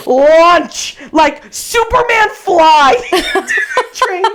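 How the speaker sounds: high, loud, drawn out, breaking into laughter; excited exclamation. Real, not acted. Storyteller reliving the leap into the train, excited. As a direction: belt out the climax, stretching it and laughing.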